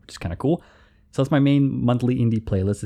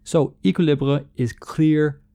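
slow, with Equilibre in a French accent; slow, lightly put-on French accent; slow attempt at a French accent; 3 semitones higher, slower than usual. Shown as a demonstration. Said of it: "I'm trying to say that with a French accent".

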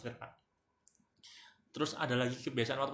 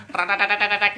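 engine rattle imitation; 7 semitones higher, 10 dB louder than usual. Mimicking a racing bike engine.

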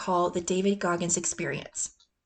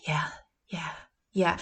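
under my breath; 3 semitones lower, 3 dB quieter than usual. Retold or imitated. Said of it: "under my breath".

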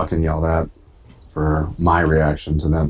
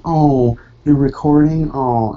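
higher, louder mimicry of an asshole reaction; mock obnoxious reaction; 9 semitones higher, 6 dB louder, much slower than usual. Retelling Stephanie's reaction to the recording, mock obnoxious. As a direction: imitate someone making an obnoxious fuss, pitched up. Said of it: "being a complete asshole about it".

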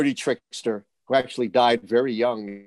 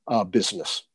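ordinary talk

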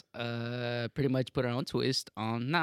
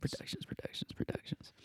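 echo whisper; 12 dB quieter than usual. Acted. Echo effect on show name.

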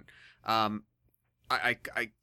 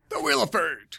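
dramatic announcer; 6 semitones higher, 6 dB louder than usual. Pretend.

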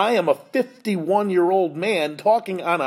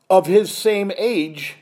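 ordinary talk

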